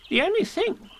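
Rabbit pondering importantly. A narrator's reading. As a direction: Rabbit stroking his whiskers, pondering.

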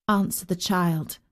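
plain reading voice

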